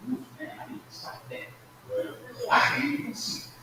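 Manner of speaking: mock whisper. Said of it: "you said".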